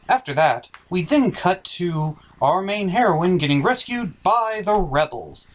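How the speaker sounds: ordinary talk, nothing marked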